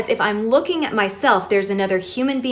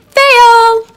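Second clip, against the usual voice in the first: a much higher, much louder mock "Fail!"; mock fail shout; 13 semitones higher, 13 dB louder than usual. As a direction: blare it out like a game-show buzzer. Announcing failed earlier attempt.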